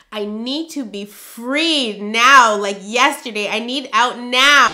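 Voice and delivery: loud, slow plea; desperate. Real, not acted. Fed up with her marriage, she pleads loudly. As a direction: dig in and demand release. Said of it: "venting desperation".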